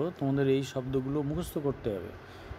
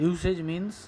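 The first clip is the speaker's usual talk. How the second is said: ordinary talk, nothing marked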